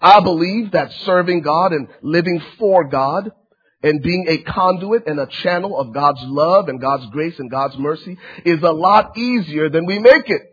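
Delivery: normal talking voice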